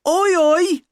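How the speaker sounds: high, loud cockney greeting; exaggerated cockney holler; hammed-up cockney. A narrator's reading. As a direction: crow it in mock cockney. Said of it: "common or garden English accent".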